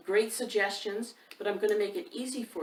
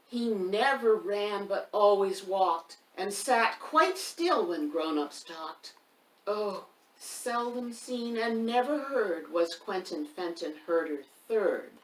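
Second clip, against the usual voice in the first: lively rhyme reading; 2 semitones higher, 5 dB louder, slower than usual. A narrator's reading. Reading a rhyming book aloud to young children, loud and sing-song. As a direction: read the rhyme out big for the little ones, leaning on the rhythm.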